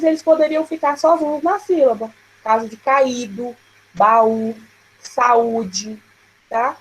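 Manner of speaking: ordinary talk, nothing marked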